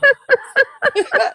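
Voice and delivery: a squealing high laugh bursts out; high burst of laughter. Real, not acted. Friend sees the cat portrait, squealing laugh. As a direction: laugh hard, high and loud. Reacting to funny self-portrait.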